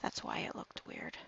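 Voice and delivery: breathy whisper to herself; a private whispered "got it"; whispered aside. Real, not acted. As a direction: mutter it under your breath, just for yourself. Muttering to herself while working.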